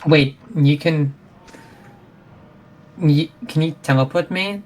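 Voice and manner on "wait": ordinary talk, nothing marked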